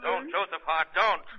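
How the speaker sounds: alarmed command; urgent plea. Acted. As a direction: stop him before he pulls the trigger. Shadow stops a man with a gun.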